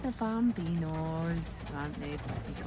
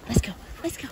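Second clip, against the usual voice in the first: ordinary delivery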